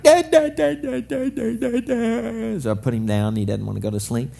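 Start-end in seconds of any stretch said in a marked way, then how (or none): 0.0-2.6 put-on high wailing baby calls, building then easing; imitating toddler crying out. Toddler Josiah screaming for Dada at bedtime, high wailing. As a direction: mimic a toddler screaming Da at night. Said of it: "He can be screaming at night".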